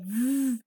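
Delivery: V held as a buzz; voiced v sound. Shown as a demonstration. Teacher names the sound for students, voiced V. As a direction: say V alone, voice box humming. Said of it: "is definitely a voiced sound".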